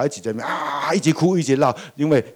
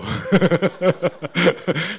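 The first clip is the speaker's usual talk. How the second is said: rising laugh; 5 semitones higher than usual. Real, not acted. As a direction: laugh climbing up high. Laughing at the child's story. (开怀大笑)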